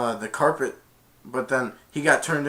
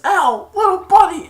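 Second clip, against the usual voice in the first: high, loud cartoon cry; character voice; 10 dB louder than usual. Child retelling Aladdin, high squeaky cartoon yell. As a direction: shout it like a cheeky sidekick.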